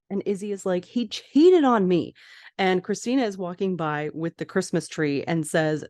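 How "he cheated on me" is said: hurt outburst. Retold or imitated. Izzy outraged at friends.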